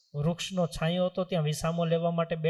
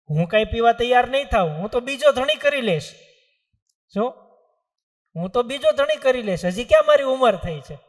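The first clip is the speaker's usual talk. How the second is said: higher, louder indignant refusal; defiant wife; 5 semitones higher, 7 dB louder than usual. Acted. Young wife refusing the saints' test, defiantly indignant. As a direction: brush it off, she'll remarry.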